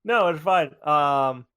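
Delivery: ordinary talk, nothing marked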